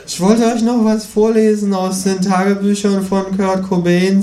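high, announcing kangaroo voice; high-pitched kangaroo; eager kangaroo. Acted. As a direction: announce a reading in a high character voice. Kangaroo figure speaking in story.

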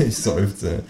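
ordinary delivery

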